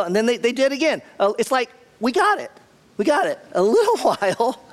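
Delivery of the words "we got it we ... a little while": high-pitched, fed-up "enough already"; playfully exasperated at the repetition, pitched up; mock exasperation. Acting out weary reaction to repetition.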